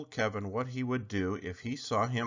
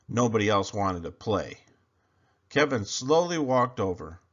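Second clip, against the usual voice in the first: ordinary delivery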